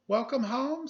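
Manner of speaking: high, bright-eyed greeting sung out; cheery welcome. A narrator's reading. Mother welcoming Chrysanthemum home, high and cheerful. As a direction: greet her like she's the best thing all day. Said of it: "said her mother".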